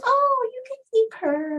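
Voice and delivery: high, bright sing-song imitation of the neighbor; neighbor lady. Neighbor lady offering her the cat, in a high mocking voice. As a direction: mimic the careless neighbor giving the cat away. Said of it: "the lady goes".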